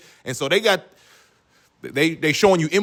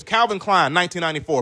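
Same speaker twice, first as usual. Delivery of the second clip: normal talking voice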